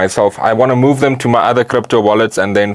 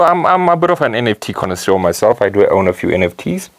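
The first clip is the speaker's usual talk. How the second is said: ordinary delivery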